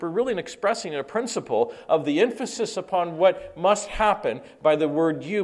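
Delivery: ordinary delivery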